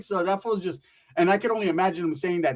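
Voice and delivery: usual speaking voice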